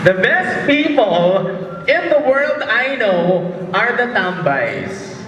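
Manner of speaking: slow, drawn-out mock tribute; mock-solemn tribute. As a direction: stretch every phrase like a grand declaration. (mabagal at pabirong taimtim na papuri)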